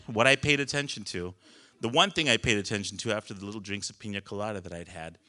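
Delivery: normal talking voice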